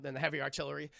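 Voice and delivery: usual speaking voice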